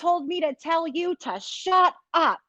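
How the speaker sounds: high, drawn-out mock scolding; retold scream. Imitating a mother screaming at her son down the phone, mock scream. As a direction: imitate an exasperated yell, pitched well up. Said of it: "She screams".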